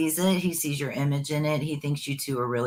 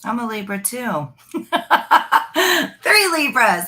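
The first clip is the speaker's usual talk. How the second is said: laughing out loud through the words, voice jumping high; cracking up at the coincidence; 8 semitones higher, 8 dB louder than usual. Real, not acted. Laughing at shared sign joke.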